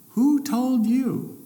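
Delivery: slow, high and solemn, God asks who told you. Acted. God questioning Adam in the garden, slow and solemn. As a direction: draw out the question like a divine voice.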